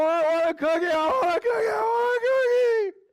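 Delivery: building to a high crying shout; toddler tantrum. Retold or imitated. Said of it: "then increases evermore".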